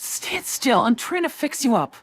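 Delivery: high-pitched fussing mother; fussing mom. Acted. Mom patching up her hurt kid, high fussing voice. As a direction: scold gently in a high mom voice.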